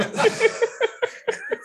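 high, loud burst of laughter; real laughter. Real, not acted. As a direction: crack up at your own joke. Laughs at her own joke.

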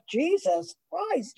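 high, slow, stunned under the breath; drawn out in disbelief; shocked reaction. Retold or imitated. Housemates recoiling at her overshare, high and slow. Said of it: "people are like".

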